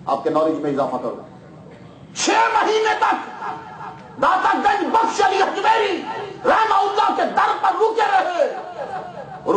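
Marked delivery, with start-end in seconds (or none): 2.2-8.5 preaching shout. Acted. Preacher proclaims at full force.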